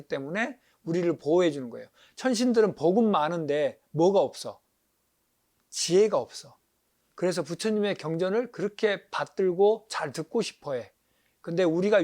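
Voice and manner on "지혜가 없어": usual speaking voice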